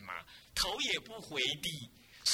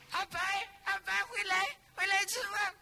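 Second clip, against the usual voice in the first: much higher call to a dog far away; 13 semitones higher, 3 dB louder than usual. Retold or imitated. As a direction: call the dog back across the yard. Monks calling the dog home. (高声呼唤狗回来吃饭)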